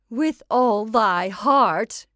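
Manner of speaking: loud, slow mocking echo; old witch's cry. A narrator's reading. Witch echoes her puppet's answer, loud drawn-out cry. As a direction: cry it out, drawing each word long. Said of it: "cried the old witch".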